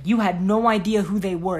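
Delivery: ordinary delivery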